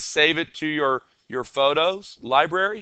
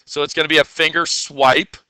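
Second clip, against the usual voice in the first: ordinary talk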